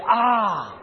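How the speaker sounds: sharp shouted "Ah" of pain. Shown as a demonstration. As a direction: yell it as if you hit your thumb. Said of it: "Some will say".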